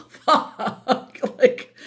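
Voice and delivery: high laughing exasperated swear; laughing curse. Real, not acted.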